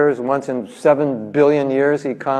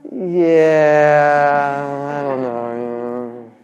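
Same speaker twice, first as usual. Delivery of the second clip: reluctant shrug; 4 semitones lower than usual. Retold or imitated. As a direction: brush off the offer lazily. Mock reluctant reply to offer.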